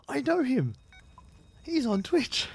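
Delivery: high, thrilled fan squeal; excited. Retold or imitated. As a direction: blurt it out like a starstruck fan. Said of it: "I'm like".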